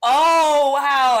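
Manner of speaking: loud, high "oh, wow"; mock surprise. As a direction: play it loudly astonished.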